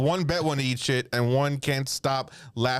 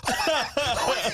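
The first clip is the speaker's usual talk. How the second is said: laughing; 9 semitones higher, slower than usual. Retold or imitated. Own quote laughing.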